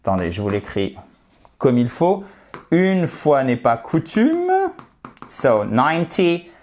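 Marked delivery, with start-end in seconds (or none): 5.6-6.5 "ninety" stressed on NINE; stress on first syllable. Shown as a demonstration. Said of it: "accentuation qui est ici". (« ninety » accentué sur la première syllabe)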